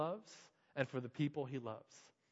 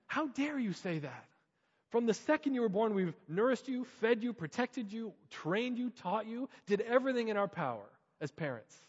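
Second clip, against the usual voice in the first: exploding parent; 7 semitones higher, 3 dB louder than usual. Retold or imitated. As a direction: let the parent's hurt boil over. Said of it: "not to explode".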